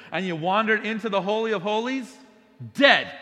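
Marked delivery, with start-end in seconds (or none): 2.7-3.1 one abrupt, loud falling blow; sudden loud verdict. Acted. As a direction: drop the word like an instant strike. Abrupt verdict after wandering in.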